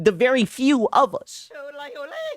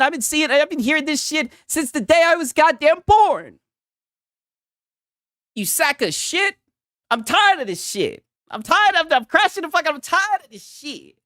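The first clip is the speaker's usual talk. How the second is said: fed-up rant; 8 semitones higher than usual. Real, not acted. Ranting about the team.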